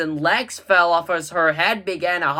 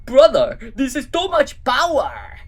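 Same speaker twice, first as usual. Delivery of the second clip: put-on higher, brighter kid brother voice; Drew's voice; 6 semitones higher, 4 dB louder than usual. Drew pleading with his brother Gru. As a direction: play the eager little brother.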